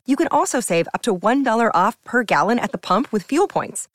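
ordinary delivery

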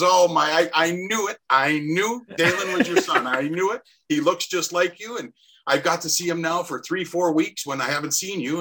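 high-pitched, excited reenactment of a friend; retold thrilled recognition.

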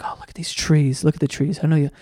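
hushed, gentle painter murmur; soft Bob Ross voice. Retold or imitated. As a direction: coo over the trees like a soothing TV painter. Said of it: "He's like".

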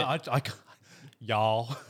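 slow, hesitant Southern drawl, laughing at itself; shy laughing attempt at a Southern accent. Shown as a demonstration. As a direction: try a Southern drawl on request, shy and giggling. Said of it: "let's hear a little bit".

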